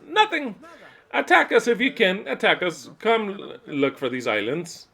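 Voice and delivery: loud, high-pitched dare; defiant taunt. Pretend. Daring the nations to come for the islands, defiant taunt. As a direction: goad the enemy at full voice. Acted challenge to enemy armies.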